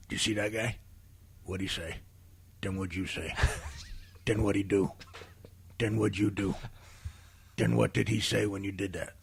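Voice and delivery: low, quiet interrogator; low-voiced uncle quietly grilling someone; Uncle Frank. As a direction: question after question in a hushed low voice. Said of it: "This is an impression you've been doing".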